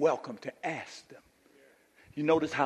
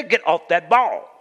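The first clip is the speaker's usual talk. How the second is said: firmer fatherly order; 3 semitones higher, 8 dB louder than usual. Retold or imitated. Father repeats order after child refuses.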